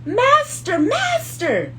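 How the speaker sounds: high alarmed scream; harp screaming. Acted. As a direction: shriek for the master. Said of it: "it screamed".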